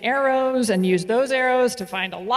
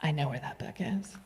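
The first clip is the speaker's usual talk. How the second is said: meek low voice; 7 semitones lower, 13 dB quieter than usual. Retold or imitated. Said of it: "he's like".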